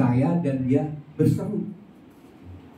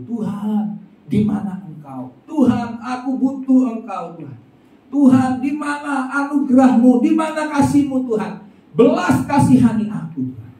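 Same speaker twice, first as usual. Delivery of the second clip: higher, longing questions to God; pleading cry; 11 semitones higher, 3 dB louder than usual. Acted. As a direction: beg God to show his mercy. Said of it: "Sepanjang hari dia berseru".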